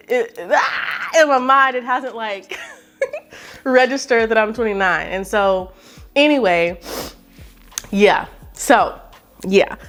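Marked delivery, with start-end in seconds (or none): 0.1-5.1 laughing. Real, not acted. Laughing at her own age confusion.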